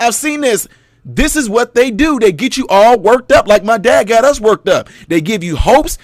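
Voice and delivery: usual speaking voice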